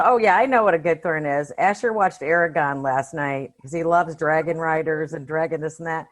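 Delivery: normal talking voice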